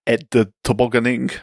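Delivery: put-on French accent. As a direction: say it like an amateur attempt at French. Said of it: "I did that in a French accent".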